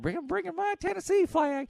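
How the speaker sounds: high-pitched imitation of a Tennessee woman; Priscilla. Brother's wife showing off her Tennessee flag, imitated high. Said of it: "Priscilla had".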